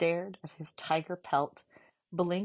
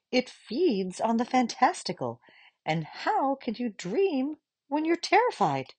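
put-on higher, coaxing old woman; grandmother voice; 7 semitones higher, 7 dB louder than usual. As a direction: coax her past her fear. Grandmother urging gently.

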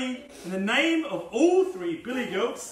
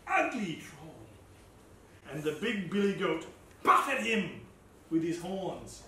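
ordinary delivery, nothing marked

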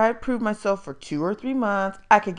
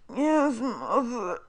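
slow, pained, high voice; milk the pain for sympathy; groaning sick boy; 5 semitones higher, 4 dB louder, much slower than usual. A narrator's reading. Todd answering his mother, slow pained groan.

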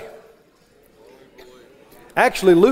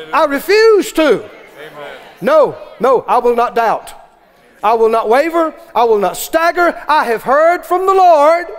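raised, driving sermon voice, slowing on key lines; full-force preaching; 4 semitones higher than usual. Real, not acted. Pastor urging the congregation to stand firm, fervent preaching. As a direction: pound the conviction into the crowd.

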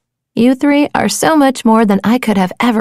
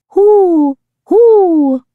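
owl hoot; 6 semitones higher than usual. Acted. Owl on scary nights.

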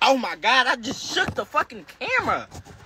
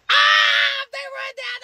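hype shout; 13 semitones higher, slower than usual. Real, not acted. Hyping up his live viewers with a shout. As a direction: shout it out to the chat.

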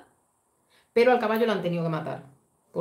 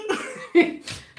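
high breathy laugh; 10 semitones higher than usual. Real, not acted. Laughing at the scene.

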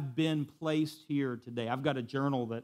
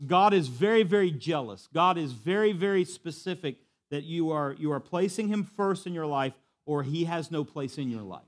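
louder, higher preaching; fervent preaching; 5 semitones higher, 7 dB louder than usual. Acted. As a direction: raise the sermon, full force.

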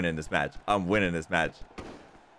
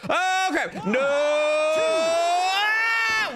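frustrated rising shout; raging gamer; 6 semitones higher, 3 dB louder, much slower than usual. Real, not acted. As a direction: yell at the screen in frustration. Losing a wrestling game match.

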